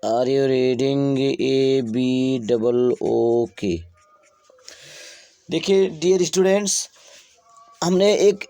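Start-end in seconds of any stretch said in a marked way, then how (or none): 0.0-3.8 very slow, drawn-out words as if writing them down; slow dictation. Shown as a demonstration. Teacher dictating the example to students, very slow. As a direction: spell it out slowly for the board.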